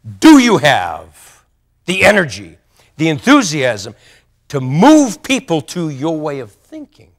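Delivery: slow, booming challenge to the mirror; forceful mirror challenge; loud self-question. Retold or imitated. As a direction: demand it of yourself, loud and drawn out. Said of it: "ask myself this question".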